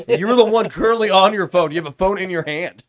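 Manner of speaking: loud, high-pitched incredulous accusation; indignant call-out. Real, not acted. Host catching a co-host on the phone, loudly indignant. Calls out a friend's hypocrisy, laughing.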